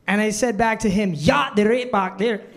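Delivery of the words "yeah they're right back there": overdone Scottish brogue; mimicked Scottish accent. Waiter accidentally copies a customer, Scottish accent. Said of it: "I mimic people's accents".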